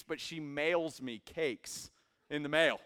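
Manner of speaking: normal talking voice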